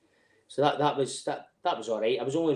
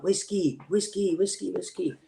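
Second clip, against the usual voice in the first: higher chant of whiskey; begging for whiskey in a sing-song; mimicking a begging local; 7 semitones higher, slower than usual. Local begging the soldier for whiskey, mock sing-song. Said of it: "he starts going like".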